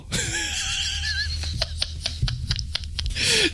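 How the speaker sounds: long, high, breathless laugh; helpless laughter. Real, not acted. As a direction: crack up completely. Cracks up at own story.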